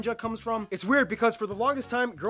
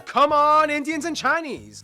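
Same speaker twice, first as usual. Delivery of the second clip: higher and much louder call; 6 semitones higher, 9 dB louder, slower than usual. Retold or imitated. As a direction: summon them loudly, drawn out. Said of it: "The Brits were like".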